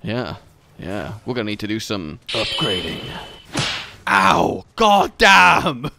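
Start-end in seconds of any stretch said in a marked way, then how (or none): 4.1-5.9 loud yell of pain; pained outburst. Real, not acted. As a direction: cry out as the hit lands. Hurt by monster in game.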